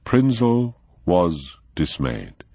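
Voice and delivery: plain reading voice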